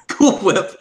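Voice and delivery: Cool Whip, exaggerated and high; mimicked catchphrase. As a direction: put a breath of H into Whip in Cool Whip.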